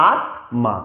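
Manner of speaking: আত্মা as tma with a distinct m; স্বতন্ত্র সংস্কৃত উচ্চারণ; Sanskrit pronunciation. Shown as a demonstration. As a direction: sound each letter in আত্মা separately. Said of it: "সংস্কৃত উচ্চারণের ক্ষেত্রে ... স্বতন্ত্র উচ্চারণ".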